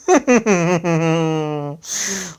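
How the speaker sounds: a drawn-out villain's laugh. Acted. Ben greets the betrayed heroes with a gloating laugh. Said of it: "Laughed Ben".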